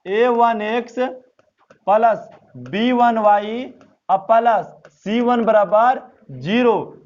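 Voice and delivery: spelling out the equation term by term, slowly; slow dictation. Shown as a demonstration. Teacher dictating the formula to students, slowly. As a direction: read it out slow so students can copy.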